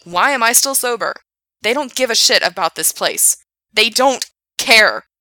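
loud angry rant; railing. Acted. Frank railing at Gerard about the kids, loud rant. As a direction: rail at him, arms up. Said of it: "he railed".

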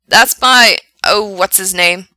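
loud start, then searching for the name; a hint of on-air projection; lightly voiced radio DJ. Frank tries to recall a writer, lightly voiced DJ. DJ chats with caller.

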